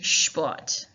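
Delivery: Sport said as "shport", sh at the start. Shown as a demonstration. Teacher showing pupils the German sh in Sport. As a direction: open Sport with a German sh, not s. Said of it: "you make the S sound like a sh sound".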